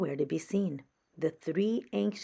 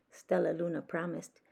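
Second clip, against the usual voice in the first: ordinary delivery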